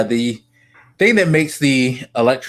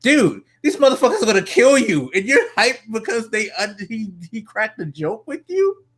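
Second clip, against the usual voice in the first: high-pitched incredulous rant; mock disbelief; 12 semitones higher than usual. Fan addressing the hero in disbelief, high-pitched and incredulous. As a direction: react like you can't believe he's hyped over that.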